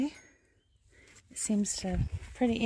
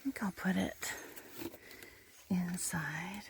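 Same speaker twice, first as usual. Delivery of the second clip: soft whisper near the animal; 9 dB quieter than usual. Real, not acted. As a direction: hush so you don't startle it.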